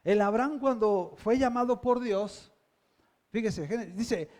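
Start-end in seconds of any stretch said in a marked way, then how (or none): none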